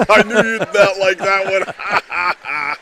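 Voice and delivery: loud, high, slowed by laughter; laughing. Real, not acted. Co-commentator teasing partner over the Maguire comparison, laughing. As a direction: crack up while teasing your partner.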